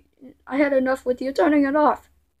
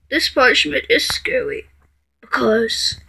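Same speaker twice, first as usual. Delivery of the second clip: usual speaking voice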